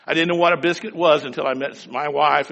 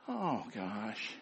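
ordinary delivery

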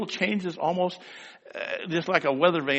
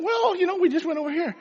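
put-on much higher, a kid answering his mom; high boy's voice; 14 semitones higher, faster than usual. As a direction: sound like an innocent little boy. Boy's evasive reply, high.

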